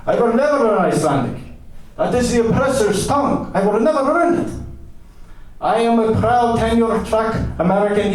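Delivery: high, proudly defiant oratory; pompous, high-pitched self-proclamation; deranged American eccentric. Acted. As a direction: declare it indignantly in a high, put-on voice.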